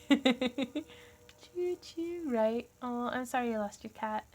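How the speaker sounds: usual speaking voice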